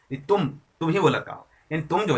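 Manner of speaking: ordinary talk, nothing marked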